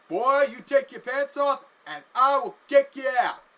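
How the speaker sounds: loud, high, drawn-out scolding; angry threat. Retold or imitated. Chef threatening to throw a customer out, angry threat. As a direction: bellow it like a fed-up boss.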